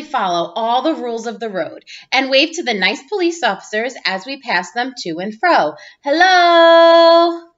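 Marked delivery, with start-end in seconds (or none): 6.0-7.5 a loud, high friendly call; calling hello. Acted. Child reading to class, calling out to the police.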